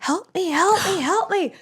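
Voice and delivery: high mock desperate cry; imitating a tourist screaming for help; a distant stranger's pleading cry, lightly acted; retold cry for help. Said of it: "somebody screaming, like".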